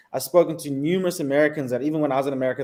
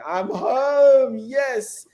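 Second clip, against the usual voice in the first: high, drawn-out cry of relief and joy; elated homecoming; 11 semitones higher, 5 dB louder, much slower than usual. Retold or imitated. Returnee kissing the ground on arriving back in Africa, elated. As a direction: re-enact a jubilant homecoming, voice lifted.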